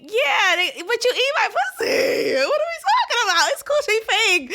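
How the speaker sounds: high, laughing disbelief; laughing incredulous rant. Real, not acted. Ranting at critics of [name], laughing and incredulous. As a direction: cackle through the outrage.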